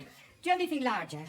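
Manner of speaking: put-on fast, prim old Scottish nanny; Mrs. Doubtfire voice. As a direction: ask it primly as the nanny. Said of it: "picked up this huge double-headed dildo and went".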